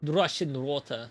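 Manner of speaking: Russian water with a rolled Russian accent. Shown as a demonstration. Gamer practising a Russian accent from a video, Russian accent. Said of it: "Russian accent".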